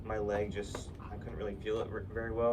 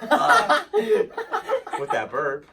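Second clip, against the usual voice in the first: words pushed out like a belch; burp-talk; 13 dB louder, much slower than usual. Shown as a demonstration. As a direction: stretch it into a big burp. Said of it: "Like that".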